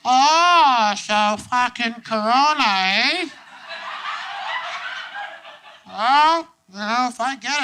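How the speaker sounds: high, exaggerated Canadian voice; hammed-up Canadian bloke; mock Canadian drawl with eh; Canadian accent. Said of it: "in the most Canadian way".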